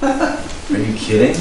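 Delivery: a hint of a worn-out parent, pleading; playfully begging a spouse to come home; overwhelmed parent. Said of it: "just kinda look at each other like".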